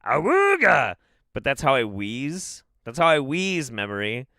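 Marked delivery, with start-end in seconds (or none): 0.1-1.0 put-on honked Awooga like an old horn; car horn impression. Laughing at his wheeze, copying a Model T horn. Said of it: "the old Model Ts".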